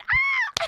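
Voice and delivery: short, very high squeal; imitated startled little shriek. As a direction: let out a loud piercing yelp. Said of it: "the squeal was enough".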